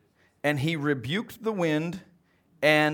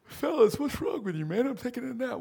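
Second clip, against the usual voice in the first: higher, groggy irritated voice; sleepy annoyed Jesus; 9 semitones higher than usual. Acted. Jesus woken from his nap, grumpy with the disciples. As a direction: grumble like you've been woken. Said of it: "Jesus was still laying down".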